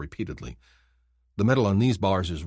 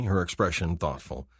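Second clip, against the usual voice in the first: ordinary delivery, nothing marked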